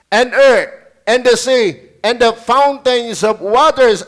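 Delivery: slow, solemn, loud reading of verse; preacher reads Revelation slowly and loudly; scripture proclamation. Acted. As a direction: proclaim each phrase slowly to the church.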